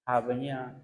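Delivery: normal talking voice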